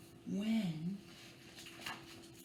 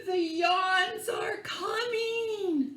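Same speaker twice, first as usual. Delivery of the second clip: gasp, then slow high fearful warning; hammed-up fright, slow and high; mock fear; 9 semitones higher, much slower than usual. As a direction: act scared for the kids. Dramatic reading, yawns arrive.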